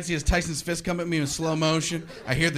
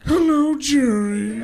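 drawn-out, loud greeting starting high; sing-out the greeting, sliding down from high; Newman; 8 semitones higher, 8 dB louder, much slower than usual. Acted. Greeting Jerry as his nemesis, drawn-out mocking hello. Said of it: "the guy who plays Newman".

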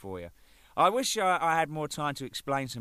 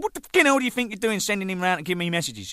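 retold angry outburst; 8 semitones higher, 6 dB louder, faster than usual. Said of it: "I said".

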